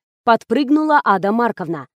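ordinary delivery

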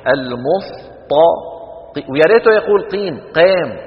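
المستقيم mocked with a heavy س, the end jumping up loud; mocking heavy seen. Teacher mocking the neighbour imam's recitation. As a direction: ham up the neighbour's thick س in المستقيم. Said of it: "يثقب أذني يوميا".

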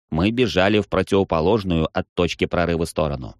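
plain reading voice

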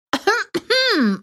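sharp high cough; sudden cough. A narrator's reading. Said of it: "Внезапно закашливается она".

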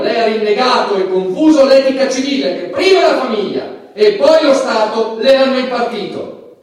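stern judicial rebuke. Acted. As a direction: hammer the verdict home. Judge scolds Bruce at sentencing.

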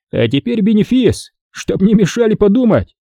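lightly voiced high cocky soldier; a hint of a wink. Soldier winking at the wounded sergeant, playfully high. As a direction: tease it out like a showman. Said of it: "подмигнул он Карцеву".

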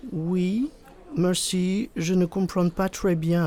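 put-on high, slow, mangled English-accented French; terrible English accent. A narrator's reading. Boy posing as English to his classmate, terrible English accent. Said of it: "avec l'accent anglais d'une vache espagnole".

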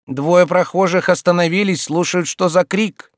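high, urgent warning; alarmed women. Acted. Women warn that passers-by are listening, alarmed warning. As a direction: warn loudly, pitched well up.

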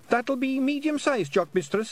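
high squeaky Scottish pixie; tiny Scots warrior, chirpy and high; Feegle voice. Acted. Feegle correcting Tiffany.

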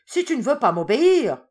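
high, very loud furious yell; raging tiger threatening his wife, shouting high and loud; furious roar. A narrator's reading. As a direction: bellow the threat at full rage. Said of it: "hurla le tigre en fureur".

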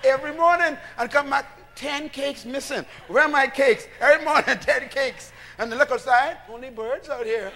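loud, rising call to the congregation; full-force preaching. Acted. Preacher calling out to his congregation, full-force preaching.

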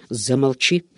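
ordinary delivery, nothing marked

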